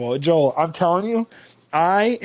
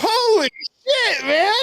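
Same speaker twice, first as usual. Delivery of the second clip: high, drawn-out retold astonishment; amazed reunion; slower than usual. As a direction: stretch it out high in amazed delight.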